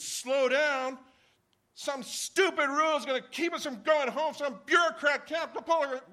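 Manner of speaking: high, loud shouting rant; angry father yelling back at his son; furious shout. Retold or imitated. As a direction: rage at the bureaucracy.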